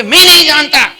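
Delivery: high, loud and defiant; stubborn loud denial. Acted. Stubborn student shouting back at the teacher, high and loud.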